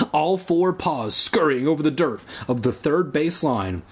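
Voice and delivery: plain reading voice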